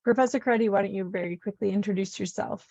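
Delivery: normal talking voice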